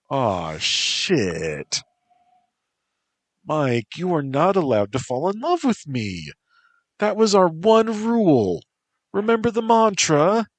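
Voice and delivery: put-on a young woman caught off guard, rising into a sharp telling-off; Lilia, alarmed and scolding.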